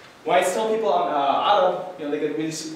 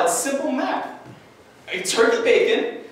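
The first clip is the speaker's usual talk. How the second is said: usual speaking voice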